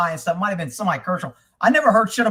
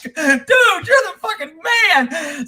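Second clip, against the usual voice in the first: high, slow, laughing cheer; laughing praise; 8 semitones higher, much slower than usual. Real, not acted. Praising the investigator who kicked out the sheriff, laughing. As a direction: crack up while praising him.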